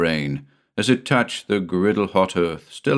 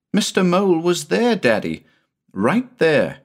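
put-on little boy voice; 10 semitones higher than usual. A narrator's reading. Crestfallen boy points out spot.

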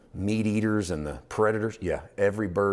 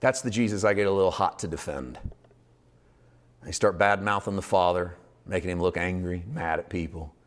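usual speaking voice

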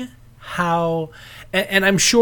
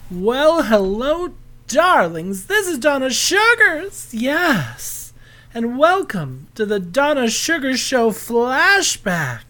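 breathy vintage purr; 6 semitones higher, slower than usual. Pretend. Host opens show in persona.

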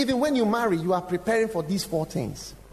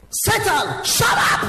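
sudden high shout at Satan; retold shout; 8 dB louder, slower than usual. Friend in tube station suddenly yelling at Satan. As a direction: bark it out like a rebuke. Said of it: "he shouted".

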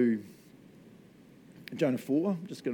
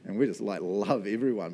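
ordinary talk, nothing marked

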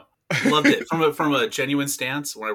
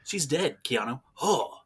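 clueless Keanu; 5 semitones higher, 3 dB louder than usual. Retold or imitated. Acting out clueless Keanu scene.

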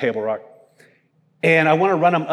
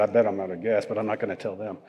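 lower, softer inner voice; private thought; 6 semitones lower, 8 dB quieter, faster than usual. Retold or imitated. Owner secretly admitting he ran out of gas, quiet and lower. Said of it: "In the back of my mind, I said".

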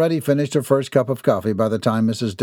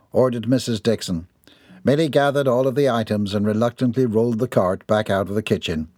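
usual speaking voice

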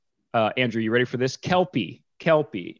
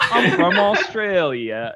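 slow, high-pitched fake Australian, laughing; bad Australian accent; 6 semitones higher, 4 dB louder, much slower than usual. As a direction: draw out a hammed-up Australian accent like Mary Poppins. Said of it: "[name]'s Australian accent now".

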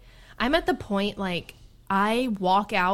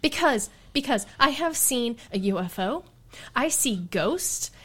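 higher, louder exasperated rant about missing Bigfoot; retold indignant rant; 5 semitones higher, 5 dB louder than usual. To her hiking buddy, reliving the orb, mock-furious rant. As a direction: vent it like the universe owes you one. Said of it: "Why are you so mad right now".

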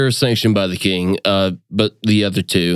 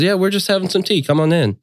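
much higher, bright welcoming sister; sister's voice; 10 semitones higher, faster than usual. Acted. Sister welcoming her brother into her office, much higher. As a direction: greet your brother happily, high. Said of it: "She says".

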